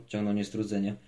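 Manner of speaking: ordinary delivery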